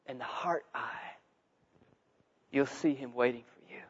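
soft, low, near-whispered appeal; hushed. Real, not acted. Preacher urging listeners to seek God, hushed. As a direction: let the voice drop almost to a whisper.

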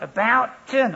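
high, loud barked command; drill command. Retold or imitated. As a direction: snap the order across the square. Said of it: "And then he would shout".

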